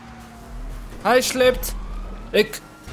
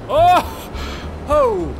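startled yelp. Real, not acted. Near crash in jet.

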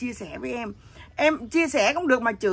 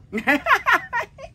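high, loud laugh; burst of laughter; 9 semitones higher, 5 dB louder than usual. Real, not acted. Laughing after telling the noodle prank, high burst of laughter. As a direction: crack up at your own prank.

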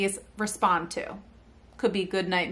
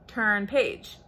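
ordinary delivery

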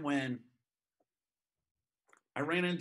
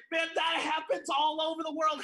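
higher, louder exclaimed retort; 8 semitones higher, 3 dB louder than usual. Retold or imitated. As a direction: hit back with fire. Retold shouted retort.

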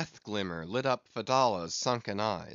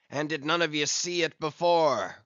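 raised, louder challenge to the crew; crying out; 4 semitones higher, 5 dB louder than usual. A narrator's reading. Ahab hailing the perched men around him, crying out. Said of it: "cried Ahab".

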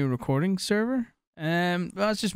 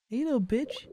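taunting call; 6 semitones higher than usual. Pretend.

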